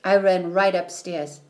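plain reading voice

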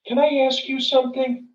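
high, timid-question imitation of a student; imitated college girl. College girl approaching a stranger at the gate, high imitated voice. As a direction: pitch it well up, as a young student. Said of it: "college girl, comes up to me".

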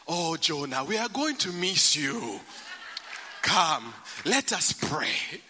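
a hint of a doting mother drawing out goodbye; African mother's farewell. Son leaving, mother starts a long goodbye prayer, lightly voiced. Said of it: "long goodbye when you're dealing with African parents".

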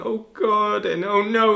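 high, loud anguished cries; distraught moaning. Retold or imitated. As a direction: cry out in horror. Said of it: "she just kept saying".

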